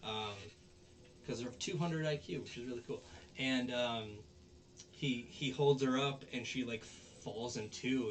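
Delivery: ordinary talk, nothing marked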